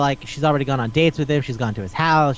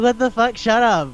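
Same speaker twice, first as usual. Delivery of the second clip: higher-pitched imitated outrage; mock disbelief; 7 semitones higher than usual. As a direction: act out an incredulous crowd dismissing him. Voices people's incredulous reaction.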